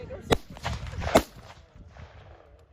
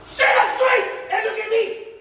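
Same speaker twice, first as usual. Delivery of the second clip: higher, louder barked order; barked command; 5 semitones higher, 7 dB louder than usual. Real, not acted. Drill instructor to seated recruits, barked order. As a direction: snap it out at them.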